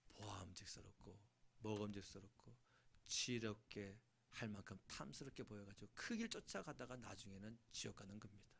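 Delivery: ordinary talk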